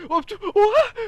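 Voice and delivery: high, loud startled yelp; a friend's high yelp of surprise; shocked friend. Retold or imitated. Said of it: "he's like".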